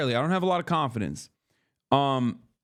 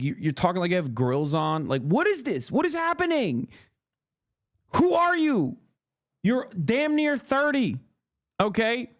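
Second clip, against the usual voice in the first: much higher, disbelieving what is this; exasperated high-pitched disbelief; incredulous; 9 semitones higher than usual. Real, not acted. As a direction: pitch shooting up in mock shock. Mocking friend's new persona.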